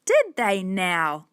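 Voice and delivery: slow, sceptical man; heavy, drawn-out sarcastic question; snorted scorn. A narrator's reading. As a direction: draw it out in disbelief. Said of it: "snorted the man".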